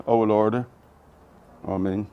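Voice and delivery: ordinary talk, nothing marked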